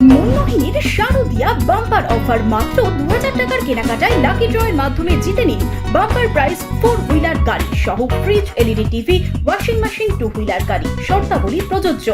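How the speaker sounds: energetic advert voiceover over music; booming prize announcer. Acted. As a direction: sell the bumper prizes like a radio ad. Festive bumper offer advert read.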